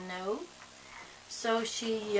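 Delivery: ordinary talk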